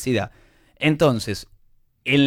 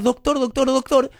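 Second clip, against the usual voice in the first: pitched-up mock shout for the doctor; father yelling for the doctor; 8 semitones higher than usual. Father in the corner between rounds, pitched-up mock yell. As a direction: imitate a pushy dad calling the doctor. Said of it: "empieza a gritar".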